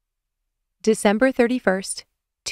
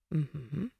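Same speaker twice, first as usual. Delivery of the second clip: soft, low breathy hum; breathed; 5 semitones lower, 13 dB quieter than usual. A narrator's reading. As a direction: breathe the agreement. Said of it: "he breathed".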